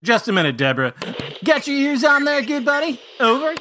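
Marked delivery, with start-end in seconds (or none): 1.4-3.5 high trucker CB voice; over walkie talkie. Pretend. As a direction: call it like a trucker on CB. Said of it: "talking to somebody on a C B radio".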